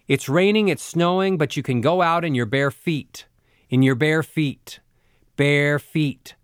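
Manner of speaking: newscaster read, "feet" held level on the same note; newscaster same-note ending. Shown as a demonstration. Coach imitating a newscaster, "bare feet" ending on a level pitch. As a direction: keep "bare feet" level at the end, no drop. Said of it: "stays on the same pitch at the end".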